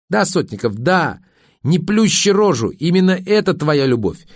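wife building to a high, angry accusation; scornful tirade. Acted. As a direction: pour contempt on his so-called art. Angry wife's tirade at husband.

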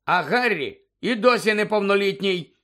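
mocking, high-pitched take on mother; mimicked motherly objection; mimicking mother. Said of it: "перекривляючи материн голос".

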